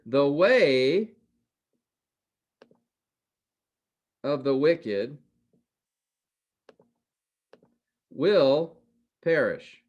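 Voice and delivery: the way and wicked spaced out, will punched; slow emphatic reading. Shown as a demonstration. Teacher pointing out the contrast to students, slow and emphatic. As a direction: deliver each phrase slowly with long pauses. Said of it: "But notice here".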